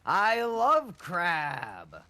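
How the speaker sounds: gushing, high-pitched delight; excitedly raving about a favorite food. Real, not acted. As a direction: gush with real enthusiasm, stretching the last words. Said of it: "You sound so excited".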